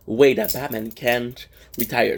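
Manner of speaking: usual speaking voice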